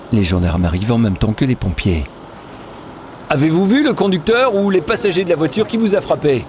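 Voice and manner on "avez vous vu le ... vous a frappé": higher, formal officer voice; gendarme questioning. Acted. (voix de gendarme formelle)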